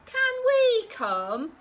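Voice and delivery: put-on slow, high, pleading little boy; little boy asking, high and loud; high child voice. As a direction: drag it out like a hopeful child. Small boy begs mum.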